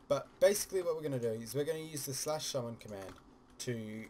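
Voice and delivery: usual speaking voice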